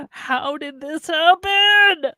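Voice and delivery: drawn-out cry building to loud and high; exasperated wail. Acted. Host despairs at guest's view, building wail. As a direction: wail it slowly, swelling at the end.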